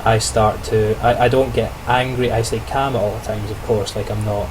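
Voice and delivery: ordinary delivery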